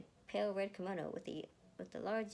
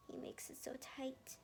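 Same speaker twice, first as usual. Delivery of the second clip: whispered, slow complaint; scoffing whisper; 8 semitones higher, 7 dB quieter than usual. Acted. Erica complaining about the binding, in a slow whisper. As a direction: grumble it under the breath. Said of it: "Erica scoffed".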